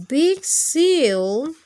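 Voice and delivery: ordinary delivery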